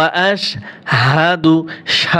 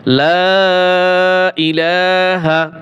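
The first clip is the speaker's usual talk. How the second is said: slow Quranic recitation; 3 semitones higher, much slower than usual. Shown as a demonstration.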